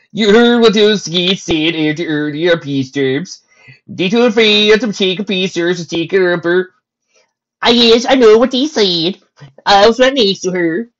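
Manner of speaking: loud, high, drawn-out character voice; stallion soldiers chatting. Acted. Soldier stallions talking over peace terms, loud and high. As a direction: push the voice up and out.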